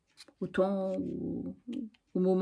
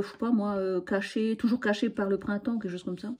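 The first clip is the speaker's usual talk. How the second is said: normal talking voice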